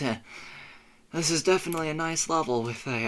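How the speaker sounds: ordinary delivery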